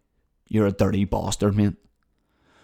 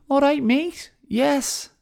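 put-on Liverpool accent; slower than usual. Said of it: "I'm gonna be in Liverpool".

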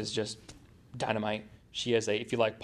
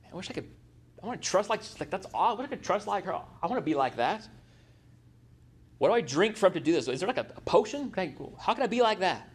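higher, pleading voice of a wishful woman; wistful woman's voice; 6 semitones higher than usual. Acted. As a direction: sigh it out like a longing church lady. Pastor voicing wistful women's wishes.

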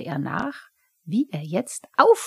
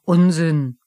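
loud, unwilling brush-off; irritated, forceful rebuff; indignant dismissal; 8 dB louder than usual. A narrator's reading. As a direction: dismiss it crossly. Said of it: "sagte deshalb unwillig".

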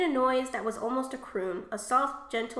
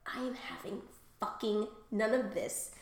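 whispered; 4 semitones lower, 6 dB quieter than usual. Retold or imitated. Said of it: "subconscious me is like".

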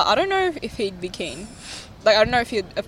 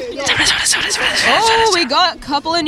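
calling out welcome; 5 semitones higher, much slower than usual. Real, not acted. Calling new guests over to join.

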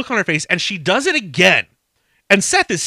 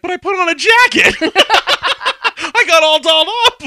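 put-on much higher, louder whiny old man kvetch; 13 semitones higher, 7 dB louder than usual. [name] complaining to Kirsten, hammed-up old man whine.

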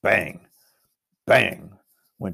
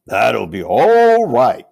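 slow, loud, grand lion voice; Aslan's reply; 10 dB louder, much slower than usual. Acted. Aslan reassures the crowd, slow and loud. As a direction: let the lion answer big and unhurried. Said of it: "said Aslan".

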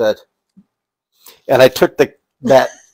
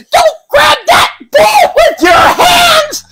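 high, loud, slow panicked shriek; mom yelling; 4 dB louder, slower than usual. Retold or imitated. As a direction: shriek it as a horrified neat-freak mom.

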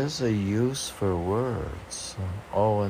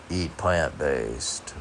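very slow, drawn out "Eat plant-based"; quoting slowly; 2 semitones lower, much slower than usual. Retold or imitated. Mocking the trendy phrase, drawn-out "Eat plant-based". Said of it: "You say".

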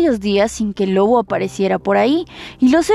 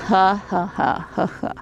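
wolf laugh; 5 dB quieter than usual. Acted. Wolf laughs after threat.

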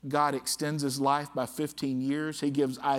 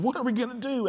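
mock panicked cry, pitched high; a king suddenly out of answers; 9 semitones higher, faster than usual. Preacher voicing a frightened king, mild mock panic. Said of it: "in a panic".

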